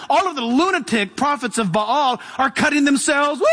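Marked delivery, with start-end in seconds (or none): 3.4-3.5 high mock whoop; frenzied whoop. Preacher imitating the prophets of Baal, frenzied whoop. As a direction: whoop like a lunatic.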